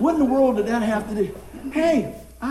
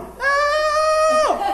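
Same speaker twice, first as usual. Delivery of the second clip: squealed high, loud cry for help like a little schoolgirl; schoolgirl scream; 5 dB louder than usual. Retold or imitated. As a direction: shriek it like a scared little schoolgirl. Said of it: "I sound like a little schoolgirl".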